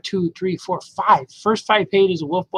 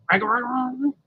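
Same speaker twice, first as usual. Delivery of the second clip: higher, odd little growl run; quick wolf growls; 7 semitones higher, slower than usual. Acted. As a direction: rattle off three cub growls. Wolf boy growls into dad's line.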